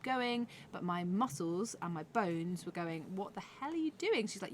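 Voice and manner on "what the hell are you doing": protesting body voice. Retold or imitated.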